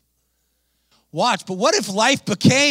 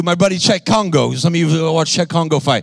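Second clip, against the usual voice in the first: ordinary talk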